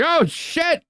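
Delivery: very high, squeaky mock yelp of shock; startled onlooker. Onlooker startled by the goblin queen, squeaky mock yelp. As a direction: jump back in fake alarm. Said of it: "It's like".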